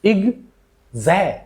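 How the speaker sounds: unstressed "इग", then a fuller "जै"; "इग", pause, longer "जै" with secondary stress; secondary stress demo. Shown as a demonstration. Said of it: "stress secondary stress है". ("इग" हल्का, "जै" पर थोड़ा ज़ोर)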